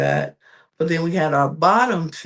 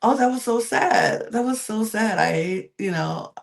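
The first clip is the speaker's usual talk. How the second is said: higher, lightly voiced imitation of a judge; imitating Law Roach; 7 semitones higher than usual. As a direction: play the judge feeling sorry, pitched up. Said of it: "Law Roach was like".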